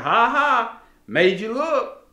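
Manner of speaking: put-on high-pitched gloating kid's tease; playful taunt. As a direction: tease them like a kid whose prank worked. Daughter teases after false alarm.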